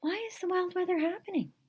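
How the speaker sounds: put-on high, fast young girl's question; a curious girl asking why; puzzled question. A narrator's reading. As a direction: ask it quickly, high and curious. Wyantess asks the question.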